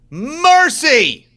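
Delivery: a loud cry of amazement at the photo; exclaimed shout. Real, not acted. Stunned by the photo.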